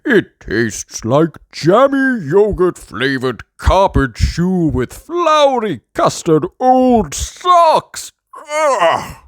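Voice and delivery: loud, slow disgusted wail; hammed-up yucky cry; Doctor Brown Bear crying out. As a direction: complain about the awful taste. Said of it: "cries Doctor Brown Bear".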